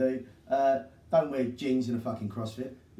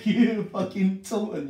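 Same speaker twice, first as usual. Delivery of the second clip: laughing; 7 semitones higher, 7 dB louder, slower than usual. Real, not acted. Mocking the town, laughing.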